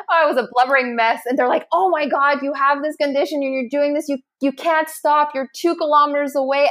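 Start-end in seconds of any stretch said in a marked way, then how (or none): 1.7-6.7 urging strangers. Retold or imitated.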